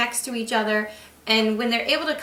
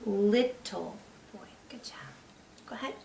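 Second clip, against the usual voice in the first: whispered model; 9 dB quieter than usual. Shown as a demonstration. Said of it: "I can read it with my finger".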